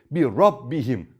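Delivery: "Rabbihim" with heavy r and open a; thick ra. Shown as a demonstration. Teacher repeating the correct thick "Rabbihim" to students. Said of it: "Kalın okuyorum".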